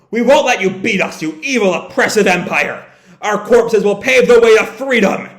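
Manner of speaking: acted rebel's rousing shout; defiant battle cry. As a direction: proclaim it as a last stand. Acted defiant speech before suicide attack.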